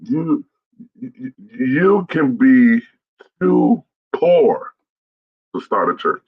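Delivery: loud, high, drawn-out "too poor"; slow declaration, dropping away on "to start a church"; emphatic proclamation. Real, not acted. Repeats key point for emphasis.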